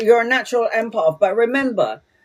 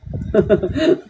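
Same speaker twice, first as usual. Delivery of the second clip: laugh. Real, not acted. Laughs at own bit.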